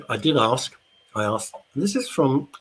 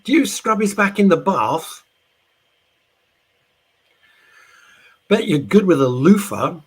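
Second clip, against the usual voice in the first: [name] impression; 8 semitones higher, 8 dB louder than usual. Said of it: "[name] continues to look at her".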